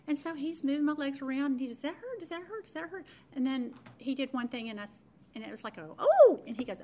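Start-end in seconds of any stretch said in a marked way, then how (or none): 1.8-3.1 high, softened repeated questions; checking doctor. Retold or imitated. Doctor moving patient's legs around, high careful checking. 6.0-6.4 sudden loud, high "oh!"; pained yelp. Retold or imitated. Patient when doctor moves her legs, mock pained cry. Retells sudden pain during exam.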